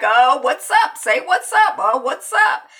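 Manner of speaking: loud, high, repeated mock hello; overeager greeting. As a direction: imitate someone butting in cheerily. Acting brother butting into call.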